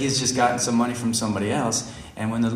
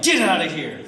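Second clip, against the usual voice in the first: acted dismissal; 5 semitones higher than usual. Storyteller kicks the car, angry mock dismissal. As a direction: send them off with a comic burst. Shouting at beggars after kicking car.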